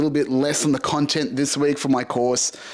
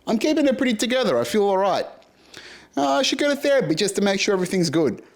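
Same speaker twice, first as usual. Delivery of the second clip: mock complacent self; 8 semitones higher, faster than usual. Mocking his past complacent self.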